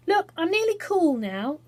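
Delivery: light and pleased; bird voice. A narrator's reading. Phoenix speaks.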